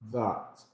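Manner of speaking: "that" with the tongue tip tapping the upper teeth; "that" softly, tongue tapping behind the upper teeth; tapped voiced th. Shown as a demonstration. Teacher showing how to tap the teeth on "that". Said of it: "like this".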